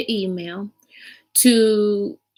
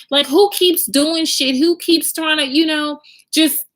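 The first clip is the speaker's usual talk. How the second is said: high, loud, tearful indignation; upset outrage; 8 semitones higher, 3 dB louder than usual. Real, not acted. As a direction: voice strained near tears with anger. Heated retelling, near tears.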